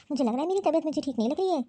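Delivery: ordinary talk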